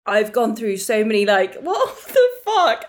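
loud, tearful, half-laughing protest; real tears with flustered laughter; unexpected crying. Real, not acted. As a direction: talk on through a wobble of crying. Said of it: "I was not expecting to cry".

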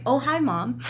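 soft mock phone voice; a hint of his polite phone greeting. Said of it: "it's how you say it".

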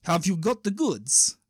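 slow Russian gangster voice; Russian accent. Acted. Dream criminal questioning the newcomer, Russian accent. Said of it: "the Russian guy goes".

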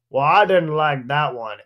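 lightly voiced slow drunk heckler; a hint of slurring-slow heckler imitation. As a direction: drag it out like a tipsy audience member cutting in. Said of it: "it just cuts through the silence".

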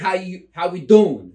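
loud, high sign-off yell; shouted catchphrase. Acted. Host yelling the podcast catchphrase to sign off, loud and high. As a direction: belt out the show's catchphrase.

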